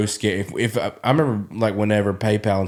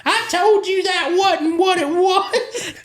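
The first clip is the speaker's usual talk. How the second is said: much higher, slow, a mocking grandma through laughter; laughing grandma voice; slower than usual. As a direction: do grandma scolding while you crack up. Grandma in background, quoted laughing.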